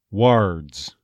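"Words" starting wuh, then hard r; central vowel "Words". Shown as a demonstration. Coach repeats after explaining, Texas "Words". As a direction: keep "Words" mid-mouth, toward uh. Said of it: "more to the middle of the mouth".

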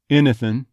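"Anything" with the kit vowel first, g dropped; Texas "Anything". Shown as a demonstration. Coach repeats the example, "Anything" as innathin. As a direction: open "Anything" on ih, end on -in. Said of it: "it'll become more like an I".

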